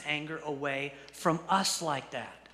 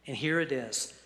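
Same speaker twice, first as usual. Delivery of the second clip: ordinary talk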